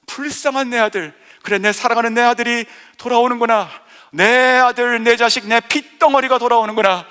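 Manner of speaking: loud, high cry of a father's joy; a father loudly cries out over his lost son. Acted. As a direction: call out over the returning son.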